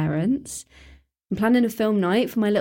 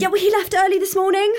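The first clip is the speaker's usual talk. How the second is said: higher and louder, near tears; distressed; 11 semitones higher, 5 dB louder, faster than usual. Acted. Girl insisting to neighbour when her dad left, upset. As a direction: insist through rising panic.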